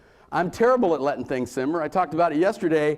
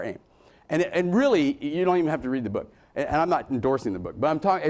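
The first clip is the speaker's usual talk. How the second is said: ordinary talk, nothing marked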